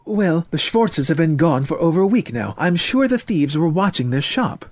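defensive mother. Acted. Mother answers son about the Schwartzes, defensive. Mother explains away the break-ins.

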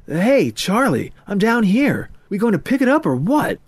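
high, loud call for attention; impatient dance partner. Acted. Short girl calls up to distracted Murray, impatient. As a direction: get his attention, pitched high and impatient.

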